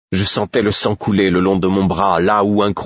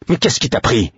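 higher, demanding furious question; angry higher question; 6 semitones higher than usual. A narrator's reading. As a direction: demand an answer angrily. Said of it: "demandai-je furieux".

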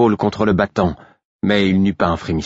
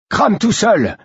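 much higher, angry spat retort; 13 semitones higher, 3 dB louder, slower than usual. A narrator's reading. Young captor abandons the prisoner to burn, spitting it out. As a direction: spit it out at a high pitch. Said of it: "cracha-t-il".

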